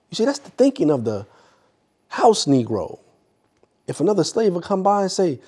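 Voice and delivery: animated speechmaker with deliberate pauses; Mister X, orator. Acted. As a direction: act out the argument for an audience, swinging up in pitch. Continues Mister X speech, mimicking servant.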